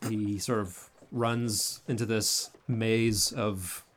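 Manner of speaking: ordinary delivery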